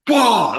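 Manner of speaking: loud high whoa of a cheering crowd; imitated crowd roar. Comedian mimicking a huge first laugh, loud high whoa.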